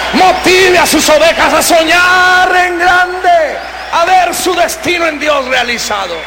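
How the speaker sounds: high, loud, slow preacher's shout; rally the congregation with full voice; preaching at full force. Acted. Pastor urges brothers to inspire their flock, fiery shout.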